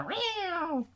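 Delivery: high, soft drawn-out meow; small cat cry; imitated cat meow. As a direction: mimic a muffled cat yowl. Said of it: "he has a cat in there".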